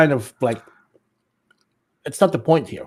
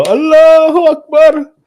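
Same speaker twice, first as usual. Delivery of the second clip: much higher, louder, slow drawn-out cry; mock shout; 14 semitones higher, 8 dB louder, slower than usual. Imitating a random man in the clip, loud mock cry. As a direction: yell it like a man cheering in the street. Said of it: "some random guy and go".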